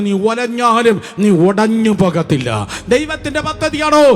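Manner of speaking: loud, high-pitched exhortation, dropping at the close; full-force preaching. Real, not acted. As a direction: preach it with rising urgency, then land it. (ഉച്ചത്തിൽ ആവേശഭരിതമായ പ്രബോധനം)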